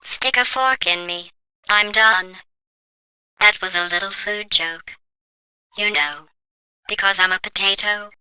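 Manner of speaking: faint, drained, barely above a murmur; weak potato voice. Acted. Trapped in a potato, quietly mocking the test subject. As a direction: speak like a battery running out, quiet and spent.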